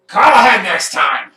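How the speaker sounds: loud, high squawked rebuke; scolding parrot. Acted. Wizard parrot scolds Perrin for not calling ahead, loudly. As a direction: scold sharply as an annoyed bird.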